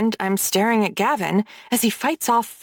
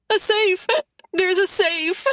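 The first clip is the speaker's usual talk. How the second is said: much higher panicked squeal; 10 semitones higher than usual. Acted. Brooks squeals in terror at Gavin's threat. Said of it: "he squeals".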